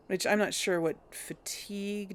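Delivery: usual speaking voice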